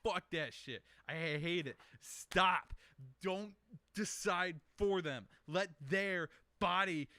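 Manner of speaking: real building anger, hammering each demand; getting mad. Real, not acted. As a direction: lecture them, fed up and heated. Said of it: "I'm getting mad right now just reenacting".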